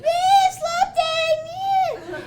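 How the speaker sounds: high, loud whooping party guy; mock drunk frat boy yell. Frat boys yelling from truck.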